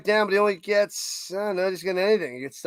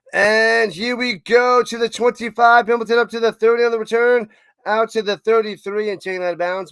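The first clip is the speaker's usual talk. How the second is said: louder, higher call tracking the return; live play-by-play; 5 semitones higher, 5 dB louder than usual. Real, not acted. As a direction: follow the runner yard by yard.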